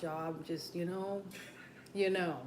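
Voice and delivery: ordinary talk, nothing marked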